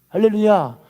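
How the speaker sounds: ordinary talk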